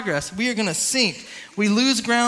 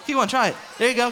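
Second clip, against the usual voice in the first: normal talking voice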